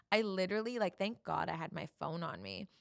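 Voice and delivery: usual speaking voice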